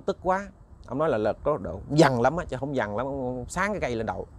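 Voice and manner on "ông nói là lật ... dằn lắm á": ordinary delivery, nothing marked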